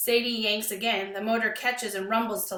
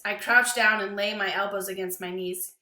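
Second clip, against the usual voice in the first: ordinary delivery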